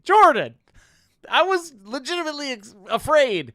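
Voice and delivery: high, loud frightened cry; mock panic. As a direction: yelp it like you were truly scared. Retelling a frightened call to friend.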